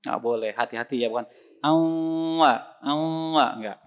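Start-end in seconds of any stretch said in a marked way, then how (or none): 1.6-3.5 Awwa pushed into the nose; wrongly nasal Awwa, sound in the nasal cavity; nasal mistake. Shown as a demonstration. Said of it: "masuk ke sini".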